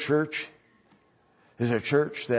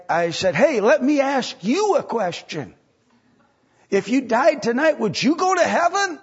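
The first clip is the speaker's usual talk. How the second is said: higher, louder eager retelling; excited telling; 11 semitones higher, 4 dB louder than usual. Acted. Churchgoer telling friends about the call, excitedly. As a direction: bubble over with the story. Acts out excited witnessing story.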